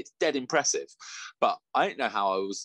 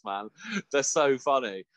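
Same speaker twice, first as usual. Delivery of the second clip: usual speaking voice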